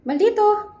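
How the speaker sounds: loud, high call of the pet's name. Real, not acted. Calling the pet by name.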